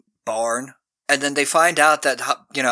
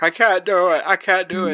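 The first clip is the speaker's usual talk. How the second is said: mock crying; 5 semitones higher than usual. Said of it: "he starts crying".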